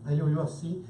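ordinary talk, nothing marked

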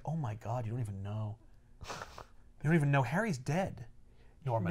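low, very soft acted realisation; hushed dismay. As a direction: break grim news under your breath. Peter realizes Norman doesn't know.

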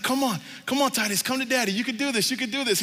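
high, eager cheering-on; encouraging dad. Retold or imitated. Dad urging his son to walk, encouraging. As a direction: coax a nervous toddler to keep coming.

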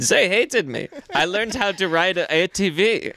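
high, wounded mock-Swedish voice; Swedish kid accent. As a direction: crestfallen exchange student with a Swedish accent. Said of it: "Just some fucking Swedish".

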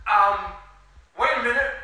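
loud, high-pitched double take; acted interruption. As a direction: cut in sharply, high and loud. Pilaf interrupts, noticing a piece.

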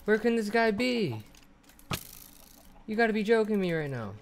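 rising panic. Real, not acted. Horse vanished, player panics.

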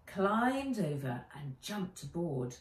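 ordinary delivery

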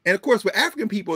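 usual speaking voice

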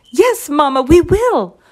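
high, loud, eager promise; plucky chicks. A narrator's reading. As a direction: answer Mama like spirited little chicks. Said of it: "puffed the chickies with pluck".

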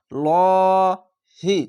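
"ল হি" with a heavy, drawn-out ল; thick L. Shown as a demonstration. Teacher shows learners the heavy L, drawn out. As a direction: make the ল thick and long, then হি. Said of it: "মোটা করে পড়বেন".

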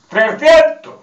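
put-on high, loud, blurted kid's answer; eager pupil. Boy answering the teacher's question, shouting it high. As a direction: blurt it out like a proud schoolboy.

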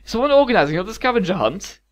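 eager, high-pitched question; keen count hungry for a game; getting excited. A narrator's reading. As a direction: ask with rising excitement. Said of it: "getting excited again".

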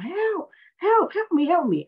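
high, frantic cry for help; panicked scream for help. Retold or imitated. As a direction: shriek for help like you're drowning. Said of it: "screaming to the top of my lungs".

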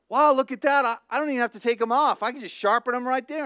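high, loud, excited discovery; thrilled at finding a shortcut. Retold or imitated. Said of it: "And I'm like".

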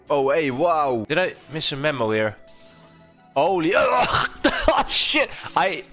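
ordinary delivery

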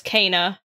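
Kena pronounced KAY-nuh; first-syllable AY. Shown as a demonstration. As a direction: give Kena its in-game sound, KAY-nuh. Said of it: "they do pronounce her name as Kena".

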